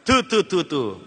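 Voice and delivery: mock "thoo thoo" of disapproval; imitated disgusted spitting. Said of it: "باہر نکلنے کے بعد تھو تھو کرتے ہیں". (حقارت سے تھو تھو کی نقل)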